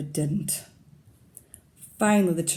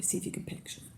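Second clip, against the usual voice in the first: soft unvoiced hint; whispered prompt; 12 dB quieter, faster than usual. Real, not acted. Teacher whispering to the class to look closely. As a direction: whisper it to draw them in. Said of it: "hushed prompt to children".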